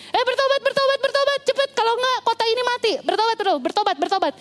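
high, harsh, pushy hammering of "bertobat"; merciless preaching. Acted. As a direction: bark "bertobat" at the city without pity. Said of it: "dia nggak pakai belas kasihan lagi menginjili".

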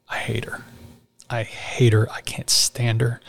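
real choked-up whisper, slowing down; fighting back tears in a broken whisper. Real, not acted. Telling his mentor about his mother, choked-up whisper. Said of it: "I'm getting a little choked up".

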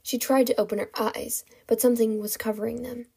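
ordinary delivery

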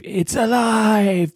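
high, drawn-out mad scientist cry. Shown as a demonstration. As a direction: stretch it out like Frankenstein's maker. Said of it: "it's like Frankenstein".

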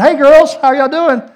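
loud, high, hammed-up hello; breezy greeting. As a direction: call out an innocent greeting, acted big. Retold greeting to wife and daughter.

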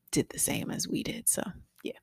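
drops to a whisper for a quick aside. Real, not acted. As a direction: whisper it under your breath. Aside whispered mid-story.

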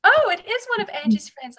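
gleeful tease, pitched right up; husband teasing. Retold or imitated. Husband repeats the joke.

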